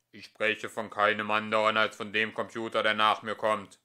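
preaching tone. Acted. The computer proclaims its successor like a preacher. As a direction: proclaim it from the pulpit. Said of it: "ihren gewohnten Predigtton".

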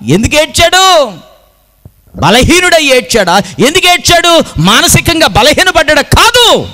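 very high, strained preaching cry; impassioned challenge. Real, not acted. Preacher challenges listeners on why Jesus wept, high and impassioned. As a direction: hurl out each question with fervour. Preacher hammers rhetorical questions at congregation.

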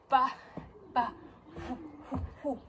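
panting between words; breathless from dancing; out of breath. Real, not acted. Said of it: "Oh, I'm so out of breath".

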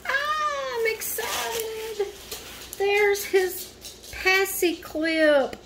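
high, slow delighted squeal; giddy excitement. Real, not acted. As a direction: stretch it out in a high thrilled voice. Unboxing baby gifts, squealing delight.

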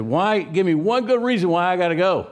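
son sitting up, fed up with his mother, exasperated. Retold or imitated. Son sits up and argues with his nagging mother, exasperated outburst. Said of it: "And he was exasperated".

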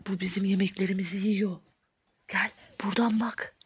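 low, very soft whisper. Pretend. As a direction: whisper while spying. Spying secretly on the intruder.

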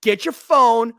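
high, loud hype-man shouting; yelling at the crowd. Retold or imitated. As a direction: rile up the whole section, loud and high. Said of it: "I'm yelling at him".

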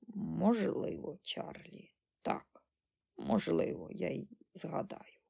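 put-on hushed, feeble old woman; frail old granny. A narrator's reading. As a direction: answer faintly, almost without voice. Ancient granny answers feebly.

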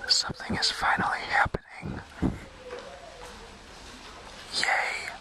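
soft whisper in the dark hall; hushed whisper, half playful fear. Real, not acted. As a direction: whisper so nobody in the concert hears. Whispering during the concert.

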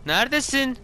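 high searching call, held long. Real, not acted. Calling out again, higher.

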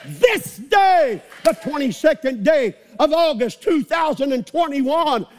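high, loud defiant shouting; shouted preaching. Acted. Preacher telling the devil off, high shout. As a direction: rebuke the devil at full voice.

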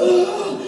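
long high-pitched jeering "ah"; mocking hoot. Onlooker points at the fallen student, high mocking hoot.

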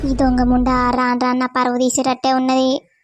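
a mother fed up with her daughter, grumbling low; angry mother. Acted. Mother muttering about daughter. (తల్లి గొంతులో తిట్టుకుంటూ, తక్కువ స్వరంలో)

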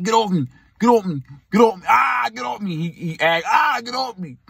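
high, building shout of get off me; mock pained shout. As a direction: boyfriend crying out in a wrist lock. Boyfriend in wrist lock yelling.

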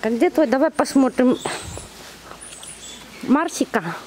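ordinary talk

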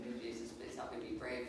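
low, soft unvoiced plea; whispered prayer. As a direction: whisper for courage.

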